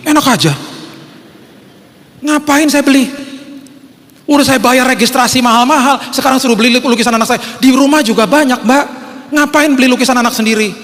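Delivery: high, worked-up complaining; indignant protest. Retold or imitated. Father refusing to buy his own child's painting, indignant and high. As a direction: refuse as if being swindled.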